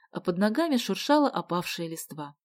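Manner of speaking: usual speaking voice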